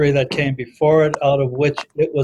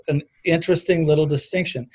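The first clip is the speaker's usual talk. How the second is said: ordinary talk, nothing marked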